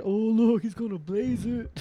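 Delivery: slow singsong tease in a put-on voice; mock teasing. Kids teasing the boy in the Blaze sweater, mock taunt.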